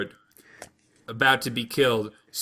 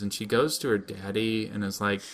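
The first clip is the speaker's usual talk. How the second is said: normal talking voice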